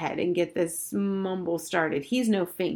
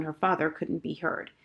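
plain reading voice

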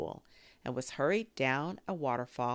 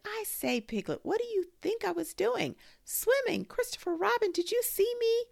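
high, loud squeaky little voice; a tiny joey showing off; little Roo squeaking with pride; squeaked; 5 dB louder than usual. A narrator's reading. Roo boasting to everyone.

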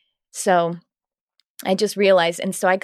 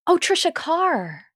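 high, drawn-out oh of recognition; mock recognition; 10 semitones higher, slower than usual. Acquaintance suddenly recognizing her, high slow mock awe. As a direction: imitate someone suddenly placing a name, slow and high.

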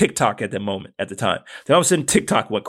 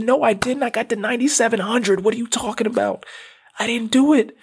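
much higher, choked-up protest; holding back emotion; 9 semitones higher than usual. Real, not acted. Arguing with his wife about the count, high and emotional. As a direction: protest while fighting to stay composed. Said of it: "try not to get emotional here".

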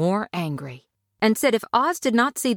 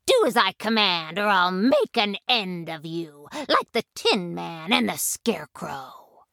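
threatening witch. A narrator's reading. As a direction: snarl the threat like a wicked witch. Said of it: "she threatened".

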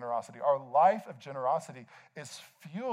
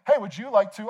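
high, raised public question; very loud cashier; 6 semitones higher, 8 dB louder than usual. Retold or imitated. Cashier to shopper at checkout, very loud. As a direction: call out so the line hears. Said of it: "the cashier asks you very loudly".

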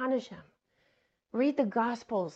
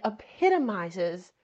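normal talking voice

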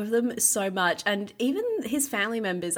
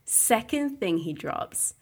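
ordinary talk

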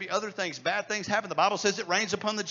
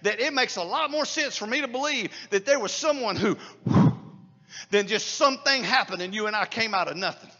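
louder, ringing pulpit preaching; preaching at full force; 5 semitones higher, 9 dB louder than usual. Acted. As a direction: hammer each "without any" home to the congregation.

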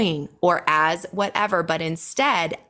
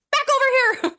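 mock yell; much faster than usual. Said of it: "yelling at her husband".

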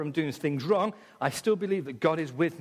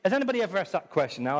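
ordinary talk

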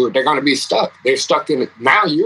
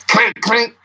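imitated clanking; 12 semitones higher than usual. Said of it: "He goes".

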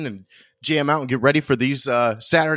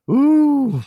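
high, loud ooh of surprise; surprised ooh; 12 semitones higher, 5 dB louder than usual. Real, not acted. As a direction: gasp it out in surprise. Reacting to a possible upset.

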